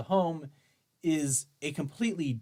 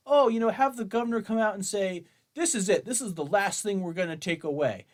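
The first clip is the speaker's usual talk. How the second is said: mocking gun owner; 6 semitones higher than usual. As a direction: parody the reader's wishful plea, a bit higher.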